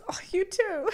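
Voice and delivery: high, bright "Oh, you too."; mock flustered reply. Imitating her own slip, high mock reply. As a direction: blurt it out flustered.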